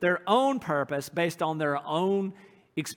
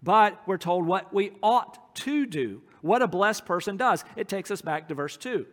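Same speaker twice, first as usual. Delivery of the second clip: usual speaking voice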